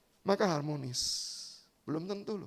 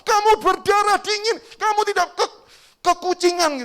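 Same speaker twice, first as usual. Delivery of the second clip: much higher, louder furious yell; angry husband; 12 semitones higher, 9 dB louder than usual. Acted. Husband yelling at his wife over the dead cat, furious. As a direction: let him explode at his wife. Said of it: "langsung marah kepada istrinya".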